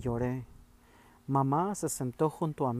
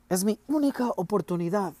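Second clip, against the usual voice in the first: loud, higher angry cry; forceful exclamation; 7 semitones higher, 4 dB louder than usual. A narrator's reading. Boy insisting to his father it's his only chance, loud. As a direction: push it hard, stressing única. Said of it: "exclamé con fuerza".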